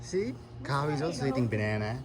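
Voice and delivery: soft coaxing. Real, not acted. Soft talk to toddler.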